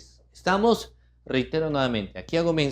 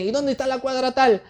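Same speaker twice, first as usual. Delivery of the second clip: traveller asking directions; 11 semitones higher, faster than usual. Retold or imitated. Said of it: "nos dicen".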